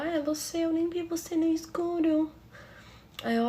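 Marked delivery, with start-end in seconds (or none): 0.0-2.3 lightly voiced, higher-pitched stepsister; imitated stepsister. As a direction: lift the pitch to mimic her. Acts out stepsister in dialogue.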